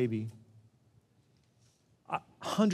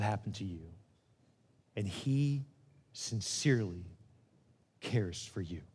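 soft, slow and sincere, with long pauses; tender reassurance; 4 semitones lower, 5 dB quieter, slower than usual. Real, not acted. Pastor assuring hurting listeners that God cares, softly and tenderly. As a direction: let each phrase settle gently, voice sinking low.

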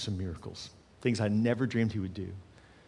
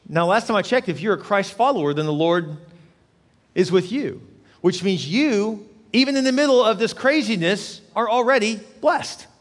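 raised voice building to a forceful high; climactic preaching; 8 semitones higher, 6 dB louder than usual. Real, not acted. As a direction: drive the blessing home to the congregation.

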